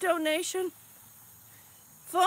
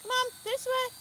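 ordinary talk

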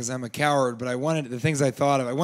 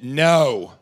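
one loud, firm word; clear blunt refusal; 5 dB louder than usual. Acted. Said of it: "he did speak clearly once".

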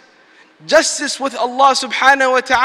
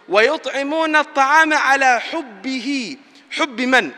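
rapid chanted Quran verse; quick recitation; much faster than usual. Acted. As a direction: recite the verse swiftly in Arabic.

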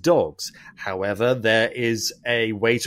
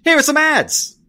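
much higher, louder cheesy ad-break voice; mock announcer; 14 semitones higher, 6 dB louder than usual. Podcast host jokingly cutting to ads, high and loud announcer. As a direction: hype up the ad break like a radio host.

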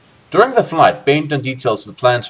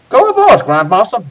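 very high, loud put-on voice; wrong character voice; 9 semitones higher, 6 dB louder than usual. Reader urging the Grandmaster aboard in the wrong voice, high and loud. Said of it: "Oh, sorry, wrong voice".

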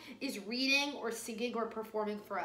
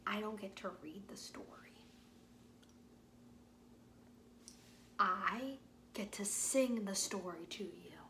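whisper; 5 dB quieter than usual. Real, not acted. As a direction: lean in and whisper the surprise. Teacher whispering a secret to children.

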